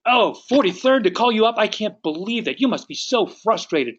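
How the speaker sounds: high, exasperated sympathy; matched frustration. Acted. Agent matching an angry homeowner's frustration, exasperated. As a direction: burst out indignant on their behalf. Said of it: "match their level of frustration".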